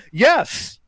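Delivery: high-pitched, eager mock agreement; emphatic yes. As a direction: imitate a keen, uncomprehending yes. Mimics brother's eager nodding reply.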